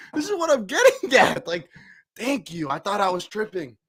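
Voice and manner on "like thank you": ordinary delivery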